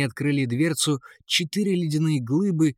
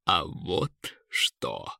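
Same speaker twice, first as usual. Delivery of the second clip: low, slow, soft eagle; cheerful eagle; 8 semitones lower, 5 dB quieter, slower than usual. A narrator's reading. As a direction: play it deep and drawn out, a touch playful. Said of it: "весело воскликнул Эрвин". (низкий протяжный голос орла)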